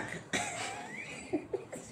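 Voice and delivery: very high, thin, quiet squeal; squealed laugh. Acted. Actress breaking off the monologue, high squealing laugh.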